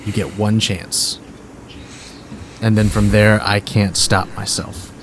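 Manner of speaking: low, measured threat; intimidation. Acted. Bard giving his opponent one last chance, intimidating. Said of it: "We'll go intimidation".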